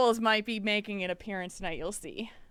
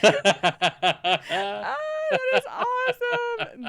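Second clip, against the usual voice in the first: high, squealing through laughter; helpless laughter breaking up the words; laughing hard; 14 semitones higher, 5 dB louder than usual. Real, not acted. Host cracking up at a picture on Twitter, laughing hard.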